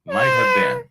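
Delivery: tiny squeaky high cat voice; kitten voice. Pretend. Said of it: "Was [name] talking like a cat".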